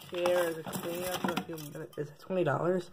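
quiet mumbled aside; muttering. Acted. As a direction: mumble it to yourself. Muttering to self.

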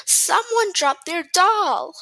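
high, slow, excited exclamation; little girl's cry. A narrator's reading. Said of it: "the girl cried".

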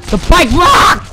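loud alarmed yell; surprised shout. Real, not acted. Gamer yelling as his bike breaks, surprised.

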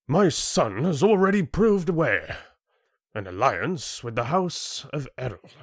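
high-pitched, self-important proposal; haughty chieftain. Acted. Chieftain proposing alliance with his house, haughty. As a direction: pronounce it grandly as a ruler.

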